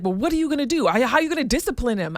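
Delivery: high, loud, fast imitated kid pressing for punishment; indignant son. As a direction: rattle it off like an indignant boy. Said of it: "He was like".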